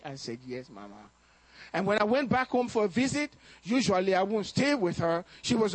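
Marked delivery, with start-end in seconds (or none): 0.5-1.1 much lower and softer "yes, Mama"; tender reply. Retold or imitated. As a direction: reassure her gently. Son reassures his mother.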